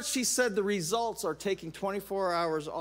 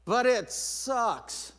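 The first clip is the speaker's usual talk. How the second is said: rueful; 4 semitones higher, much slower than usual. Real, not acted. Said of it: "admits difficulty".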